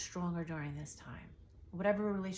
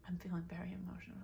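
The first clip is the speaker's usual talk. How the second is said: whisper, overcome and about to cry; emotional whisper; 9 dB quieter, faster than usual. Real, not acted. Psychic moved to tears, whispering to the viewer. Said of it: "I'm gonna cry right now".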